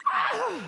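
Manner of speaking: high frustrated "ah"; short, high yell of exasperation. Acted. Grown son fed up with his mum, frustrated yell. Frustrated cry ending the quarrel.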